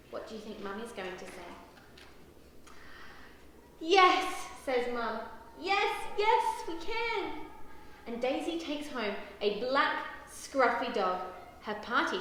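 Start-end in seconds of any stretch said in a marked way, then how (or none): 3.8-4.5 loud, higher burst of agreement; delighted yes. A narrator's reading. Mum lets Daisy keep the puppy, delighted. 5.6-7.5 high, loud yeses easing to usual volume; delighted agreement. A narrator's reading. Mum tells Daisy they can keep him, delighted.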